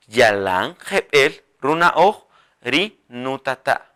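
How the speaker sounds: phrase spoken slowly and evenly; slow dictation. Shown as a demonstration. Teacher models the full sentence slowly. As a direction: take it slowly. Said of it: "Así".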